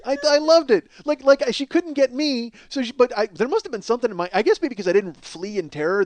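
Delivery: usual speaking voice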